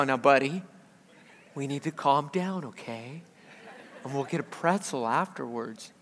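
hushed, low, patient dad voice; good father act. Retold or imitated. Father kneels to his melting-down toddler, softly pretending patience. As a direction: gently talk a toddler down, quiet and low. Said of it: "pretend to be a good father".

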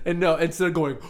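ordinary talk

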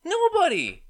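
loud, high exasperated "Nobody."; yelling "Nobody." in frustration; exasperated shout. Real, not acted. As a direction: burst out with "Nobody.".

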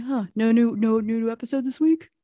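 a mock-disappointed listener; Nathan's voice. Nathan quoted messaging.

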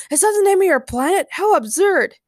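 high, quick scornful disbelief; incredulous, derisive king; mocking amusement. A narrator's reading. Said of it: "laughed King Ray".

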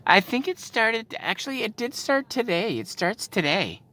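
high-pitched, hesitant helpful clerk; mocking impression of a cashier chiming in; imitated cashier. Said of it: "but the fucking cashier decides".

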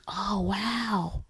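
breathy whispered oh wow, imitating her. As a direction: hush it out like a stunned friend. Friend's amazed reaction, retold.

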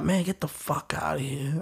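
a hushed, shaken curse under the breath; disbelieving whisper. Retold or imitated. To himself on learning his brother may be dead: disbelieving whisper.

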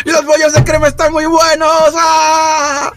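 high, wild cries of pain and glee; manic pained outburst. Acted. Wounded character rants madly at the table, high and wild. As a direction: howl and babble like you're losing your mind.

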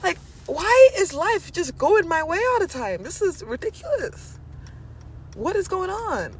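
high, incredulous gushing; amazed at own luck. Acted. Voices amazed affirmations of luck.

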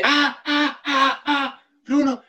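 high-pitched mock screams, volume held back; put-on high scream for a story; imitated screaming. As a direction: re-enact panicked cries without really yelling. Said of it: "Y después yo grité".

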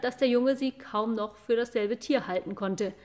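plain reading voice

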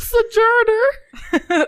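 stretched very slow and high with a laugh; drawn out. Pretend. Offering a pilgrim synonym, drawn out. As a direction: savor the word, slowly. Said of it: "drawn-out word play".

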